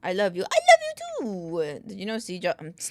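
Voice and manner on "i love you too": mock sweet reply. Answering a chat message.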